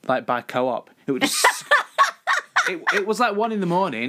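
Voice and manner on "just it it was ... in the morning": laughing. Real, not acted. Laughing through badger story.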